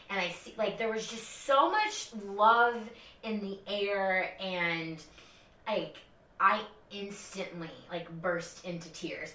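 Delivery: ordinary talk, nothing marked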